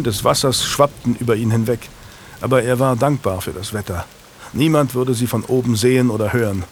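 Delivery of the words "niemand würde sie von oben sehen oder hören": plain reading voice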